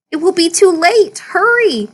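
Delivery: storyteller's excited urging; urgent. Acted. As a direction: cheer it on: hurry up. Narrator urging the seed on.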